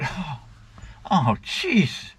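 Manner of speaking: mock exasperation, voice lifting on each jeez; exasperated doctor. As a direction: act out a doctor barely holding back. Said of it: "see the look on his face".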